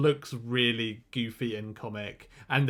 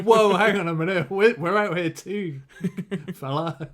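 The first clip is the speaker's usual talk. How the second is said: alarmed shout; 7 semitones higher, 9 dB louder than usual. Retold or imitated. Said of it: "they're like".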